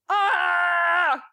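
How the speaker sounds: high drawn-out squeal of joy; excited scream. Real, not acted. Co-host cheering a second season, excited scream. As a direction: let out a long, high, thrilled scream.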